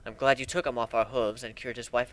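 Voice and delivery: ordinary delivery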